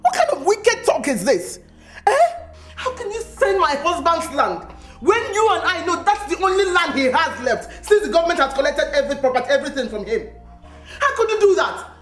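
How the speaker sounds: heated rant, pitch climbing through the questions; indignant ranting. Acted. Wife confronting the man who sold her jailed husband's land, ranting. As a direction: fire off outraged questions, voice rising. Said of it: "Stop ranting, woman".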